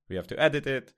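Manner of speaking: ordinary talk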